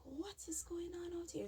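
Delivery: whispering in the dark hallway; hushed whisper. Retold or imitated. To the stepmom in the night, whispered. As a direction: hush it, scared to be heard. Sneaking out, hearing silence.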